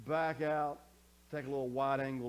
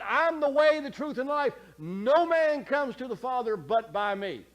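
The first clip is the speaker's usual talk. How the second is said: forceful scripture reading; 9 semitones higher, 11 dB louder than usual. Acted. Pastor preaching Jesus' words to the congregation, loud and high. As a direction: proclaim it like a fiery preacher.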